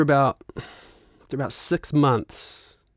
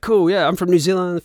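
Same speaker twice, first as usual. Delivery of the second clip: higher and louder, keen to impress; eager; 7 semitones higher, 6 dB louder than usual. Retold or imitated. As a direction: sell yourself brightly to a new bandmate. Eager reply to the bandleader.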